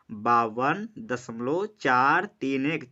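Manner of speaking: slow, each digit "चार तीन एक" spaced out; slow teacher read-along. Shown as a demonstration. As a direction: spell the digits out slowly for the class. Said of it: "इसको ऐसे पढ़ने की कोशिश करिए".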